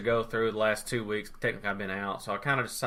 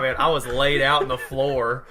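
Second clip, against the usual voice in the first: normal talking voice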